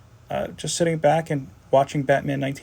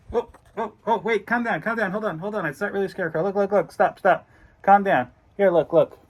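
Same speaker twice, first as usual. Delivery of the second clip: high soothing voice; 7 semitones higher than usual. Acted. Calming the scared viewer.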